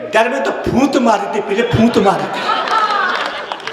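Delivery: lisping दर and फूंत with a laugh; lisping girl. Acted. Lisping bride answers the lisping groom, laughing. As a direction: answer back in the same lisp, laughing. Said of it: "लड़की बोली".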